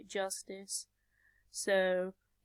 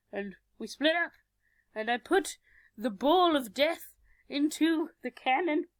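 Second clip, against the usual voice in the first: usual speaking voice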